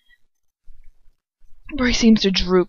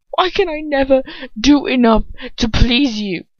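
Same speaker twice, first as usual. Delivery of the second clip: higher, louder, voice tight with held-back anger; shaking suppressed anger; 6 semitones higher, 5 dB louder than usual. A narrator's reading. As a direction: let the anger leak through a shaking voice. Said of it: "His voice shakes with suppressed anger".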